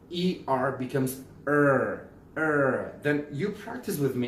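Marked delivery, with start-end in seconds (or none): 1.5-2.9 ER drawn out with a clear American r; clear ER sound. Shown as a demonstration. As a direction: hold ER on a strong r colouring. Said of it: "sound at the end of the word in a clear way".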